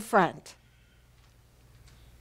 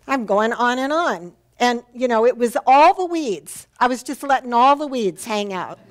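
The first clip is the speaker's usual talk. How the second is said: normal talking voice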